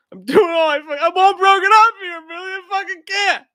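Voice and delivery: high, loud mock wailing; hammed-up broken-hearted lament; mock despair. Mock melodrama complaint.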